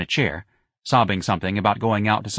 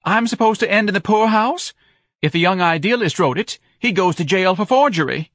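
high, loud, outraged tirade; pitched-up hysterical outburst; screaming wife; 11 semitones higher, 6 dB louder than usual. A narrator's reading. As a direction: keep shrieking at your husband. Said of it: "Missus Levy screamed at her husband".

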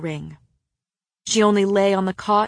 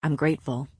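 low and soft; quiet, tender man; 4 semitones lower, 4 dB quieter than usual. A narrator's reading. Man thanking his mate before battle, tenderly. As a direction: confide it low and gently.

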